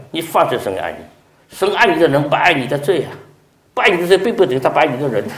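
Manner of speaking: ordinary talk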